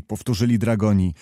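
ordinary delivery, nothing marked

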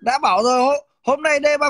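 loud amused comeback; loud laughing retort. Real, not acted. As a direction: burst out loud through a laugh.

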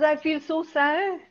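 teacher voicing Miranda's sad apology to father; mock Miranda. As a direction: play her as a grieving girl to her father. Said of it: "She says".

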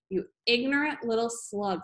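usual speaking voice

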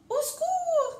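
very high, loud storybook shriek; hammed-up panicked squeal; cry for help. A narrator's reading. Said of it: "cria-t-elle".

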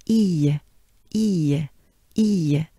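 "E" as a spread-lip French vowel; French E sound. Shown as a demonstration. Teacher drilling the E sound for the class. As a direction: smile wide through each "E". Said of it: "this sound E goes into O".

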